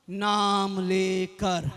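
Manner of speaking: drawn out low and soft; slow, low answer. Real, not acted. Preacher tells congregation how God called Moses, slow and low. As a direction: stretch each word, dropping the voice.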